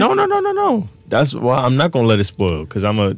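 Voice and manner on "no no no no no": high-pitched no. Real, not acted. Sudden high squeal denial.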